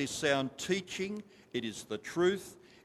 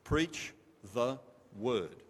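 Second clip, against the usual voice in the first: slow emphatic; 2 semitones lower, much slower than usual. Shown as a demonstration. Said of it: "Can you remember that?".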